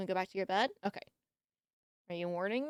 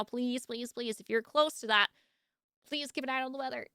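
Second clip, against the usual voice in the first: ordinary talk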